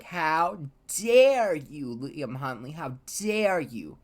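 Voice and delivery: slow, drawn-out outrage, DARE punched loud; retold theatrical confrontation; mock outraged yell. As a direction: imitate an indignant yell, stretching "dare". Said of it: "they were screaming at each other".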